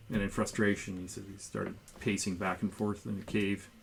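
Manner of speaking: ordinary delivery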